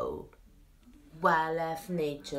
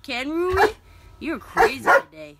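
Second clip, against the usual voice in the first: excited praise; 8 semitones higher, 11 dB louder than usual. Real, not acted. Excited at the dog.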